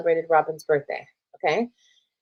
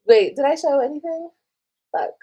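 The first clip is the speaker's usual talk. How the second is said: normal talking voice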